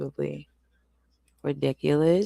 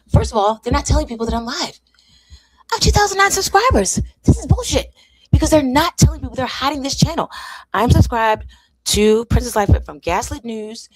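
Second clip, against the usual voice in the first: much higher, louder and fast; indignant rant; 7 semitones higher, 9 dB louder than usual. Real, not acted. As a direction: fire off the outrage at YouTube. Ranting YouTube hides her stream.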